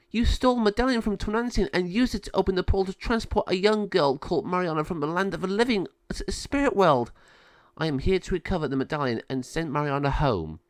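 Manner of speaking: earnest wise sister. Acted. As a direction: lay out the charges firmly and fast. Sabio accuses Malvador.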